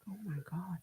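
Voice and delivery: breathless whisper of fear; frightened whisper. Retold or imitated. Reliving her terror at the body bag, whispered. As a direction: whisper it, terrified and unsure. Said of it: "And I was like".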